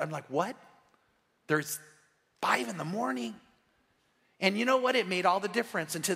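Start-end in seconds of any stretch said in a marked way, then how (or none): 0.3-3.4 retold incredulous reaction, halting between phrases; hammed-up double take; mock disbelief. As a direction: act out mild astonishment, pausing before the echo. Acts out his disbelief at early rising.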